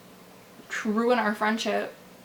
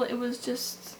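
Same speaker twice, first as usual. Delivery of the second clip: usual speaking voice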